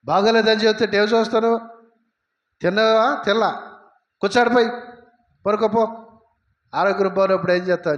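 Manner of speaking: high, loud retold scolding of a boy by his father; father's harsh scolding. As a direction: snap at the child like a stressed father, loud and high. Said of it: "మా నాన్న గట్టిగా మాట్లాడాడు".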